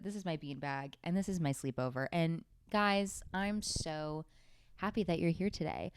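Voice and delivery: ordinary talk, nothing marked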